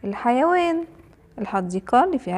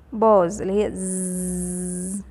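long, drawn-out zzz; bee buzz; 3 semitones lower, slower than usual. Shown as a demonstration. Teacher shows children the sound of a bee, buzzing. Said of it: "صوت النحلة يا ولاد".

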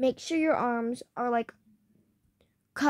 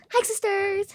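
"Hi, sisters." said higher and louder; testing voice with ears blocked, higher; higher test greeting; 8 semitones higher, 4 dB louder than usual. Real, not acted. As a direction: call it out brightly higher. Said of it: "so this is how you hear me".

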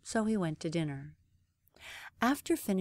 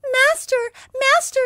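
very high, loud cry of a magic harp; calling harp; 15 dB louder than usual. Acted. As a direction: call out for help in a tiny high voice. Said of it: "called the harp".

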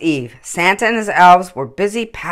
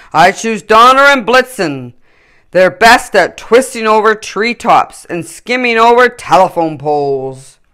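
higher, louder, cheerful Santa; jolly Santa; 5 semitones higher, 9 dB louder than usual. A narrator's reading. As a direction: beam as Santa completes his team. Santa's voice in story.

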